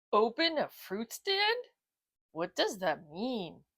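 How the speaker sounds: slow, puzzled dog voice; puzzled bulldog. Acted. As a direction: sound confused and slow. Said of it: "Otis asked, scratching his head".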